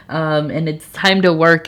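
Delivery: ordinary talk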